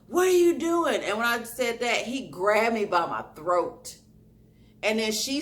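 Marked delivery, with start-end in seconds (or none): 0.1-1.0 high, loud confrontation; acted-out shout at an attacker; retold crazy yell. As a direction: re-enact yelling like a crazy person. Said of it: "I yelled at him like I was crazy".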